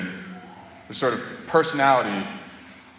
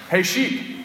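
a higher rising call to the sheep; calling out again; 6 semitones higher than usual. Retold or imitated. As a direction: call out hey, lifting up at the end. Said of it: "and I was like".

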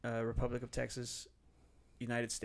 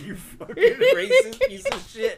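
loud, slow mock threat through laughter; playful laughing scolding; laughing mock outrage; 15 dB louder, slower than usual. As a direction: fake fury while cracking up. Mock outrage at friend's joke.

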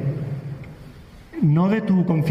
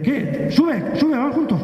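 high-pitched boatman calling someone aboard; calling out invitation; 10 semitones higher, slower than usual. Acted. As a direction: call them over eagerly, pitched well up. Boatman calls him aboard.

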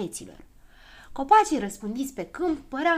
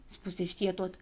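plain reading voice

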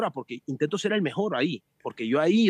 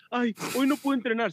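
higher mock whining; mock whiny cyclist; 6 semitones higher than usual. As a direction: moan it like a spoilt amateur. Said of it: "se enfada". (quejica burlón, más agudo)

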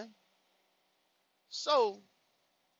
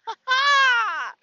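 shrill imitated "ha, ha" cackle, much higher and loud; a squeaky mocking laugh; 11 dB louder than usual.

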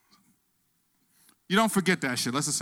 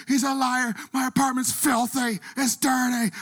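high, loud panicked wailing in a put-on woman's voice; hysterical woman; 12 semitones higher, 7 dB louder than usual. Retelling the bleeding woman, shrieking high and loud. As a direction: play her freaking out, voice cranked way up. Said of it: "She's going crazy".

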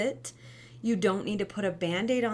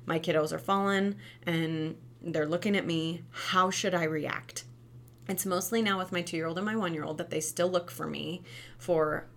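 ordinary talk, nothing marked

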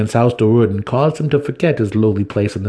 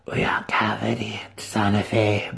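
put-on hushed, breathy old crone; a withered ruler's cold greeting; ancient matron; 4 dB quieter than usual. Matron Banray opens the council, a hushed ancient voice.

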